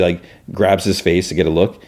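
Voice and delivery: ordinary talk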